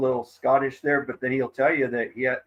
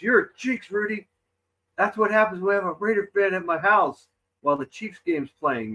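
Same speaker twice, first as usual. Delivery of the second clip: higher-pitched mock scolding; lightly acted, pitched-up grumbling; retold mad brother; 7 semitones higher than usual. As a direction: imitate an annoyed brother blaming you. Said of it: "my brother got mad at me".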